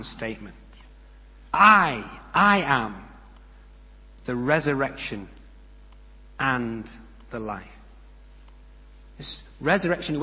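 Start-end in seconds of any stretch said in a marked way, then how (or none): 1.5-7.8 loud ringing I, then slowing down on the life; booming start that settles into a slow close; emphatic I am. Acted. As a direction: proclaim it, punching the first I. Jesus' I am statement.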